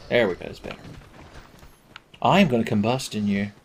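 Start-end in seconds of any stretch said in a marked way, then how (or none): none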